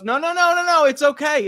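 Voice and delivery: high and loud, hurried reassurance; a husband brushing off his wife's worry; placating husband. Acted. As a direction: wave her off, no big deal. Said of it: "And the guy goes".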